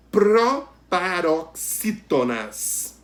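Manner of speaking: teacher sounding out the new term "proparoxítonas"; syllable by syllable. Shown as a demonstration. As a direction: announce "proparoxítonas" slowly, hitting the first syllable.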